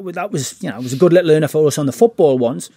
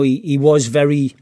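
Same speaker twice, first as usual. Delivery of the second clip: ordinary talk, nothing marked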